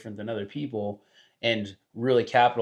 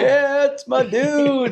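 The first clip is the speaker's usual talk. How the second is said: much higher, louder and slow hammed-up Guy Fieri; 14 semitones higher, 7 dB louder, much slower than usual. Guest plays along with the host's celebrity chef bit, loud mock Guy Fieri. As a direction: stretch it out like a TV chef hype man.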